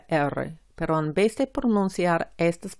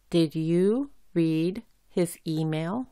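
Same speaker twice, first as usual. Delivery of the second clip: slow, careful, every word separated; slow model reading; slower than usual. Shown as a demonstration. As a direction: read it out slowly for learners. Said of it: "por ejemplo". (lectura lenta y clara para practicar)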